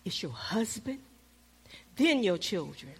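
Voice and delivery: soft, low whisper; hushed voice of God. Retold or imitated. The Lord setting her priorities, in a soft low hush. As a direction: murmur the order of priorities. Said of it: "He says".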